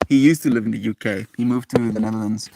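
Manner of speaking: ordinary talk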